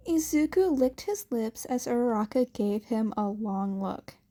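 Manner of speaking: plain reading voice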